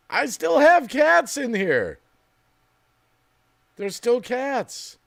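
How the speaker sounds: high, slow amused realization; amused surprise. Real, not acted. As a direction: draw it out in disbelief. Surprised at cats in scene.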